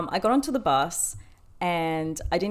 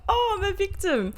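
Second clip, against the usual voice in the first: high, mocking mimicry of a victim; imitated scoffing. Boomers on the bus mimic the upset passenger, scoffing. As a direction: put on a mock-pitiful high voice. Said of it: "scoffing about me being like".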